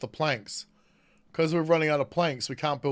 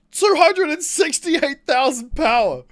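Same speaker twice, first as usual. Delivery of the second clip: loud, high, slow amazed call; excited; 11 semitones higher, 8 dB louder, slower than usual. Real, not acted. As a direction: announce it in awe. Big number in game.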